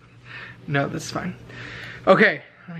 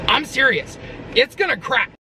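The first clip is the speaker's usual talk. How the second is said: insisting janitor; 8 semitones higher than usual. Retold or imitated.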